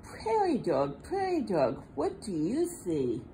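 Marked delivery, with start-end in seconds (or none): none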